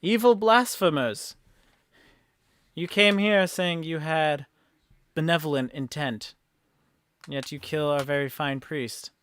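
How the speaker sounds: loud opening, deliberate righteous accusation; accusing mayor. Acted. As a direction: denounce the wrongdoers before a crowd.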